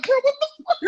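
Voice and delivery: high, shocked outcry; shocked outburst. Retold or imitated. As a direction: yelp it in disbelief. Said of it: "I'm like".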